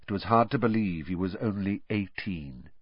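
ordinary delivery, nothing marked

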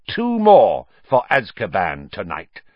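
gleeful triumphant threat; fanatical. A narrator's reading. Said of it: "eyes now gleaming fanatically".

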